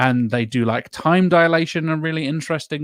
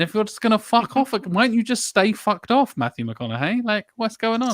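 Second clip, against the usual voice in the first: high-pitched disbelieving rant; exasperated rant at McConaughey, voice pitched high; 10 semitones higher, 4 dB louder than usual. Real, not acted. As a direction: pitch it way up in outrage. Ranting at the character.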